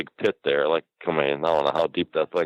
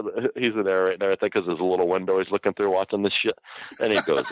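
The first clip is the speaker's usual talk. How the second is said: ordinary talk, nothing marked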